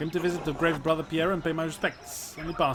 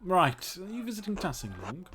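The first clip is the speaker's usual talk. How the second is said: usual speaking voice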